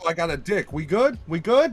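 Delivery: hammed-up bro voice. Podcaster acting out how he'd announce it, building to a high loud mock check.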